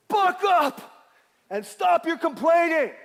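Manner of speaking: rising to a huge high shout; preacher's sudden roar. Real, not acted. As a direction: bellow it at the congregation.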